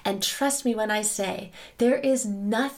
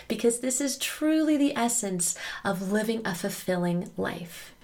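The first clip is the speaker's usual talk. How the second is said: ordinary talk, nothing marked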